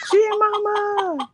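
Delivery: high, slow, drawn-out call. Real, not acted. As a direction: call her name across the room. Calling out to arriving guest.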